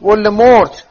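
Harry pushing back at Ron, loud and emphatic; emphatic outburst. Acted. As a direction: say the name loud and defiant. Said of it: "هری با تأکید به حرفش ادامه داد".

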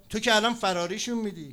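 high, loud complaint; imitated pushy complainer; acted protest. Informer protests at the shouting.

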